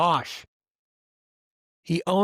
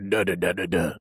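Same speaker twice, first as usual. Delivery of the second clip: much lower wordless da-da; imitating a deep voice to the camera; deep mock voice; 9 semitones lower than usual. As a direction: grunt it low like a deep-voiced athlete. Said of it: "she has a voice where it's".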